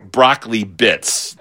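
ordinary talk

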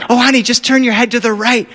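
high-pitched parental urging; acted coaxing. Dad helping his daughter find the rainbow, coaxing in a high voice. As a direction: coax a small child along.

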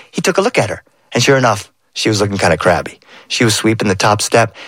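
ordinary delivery

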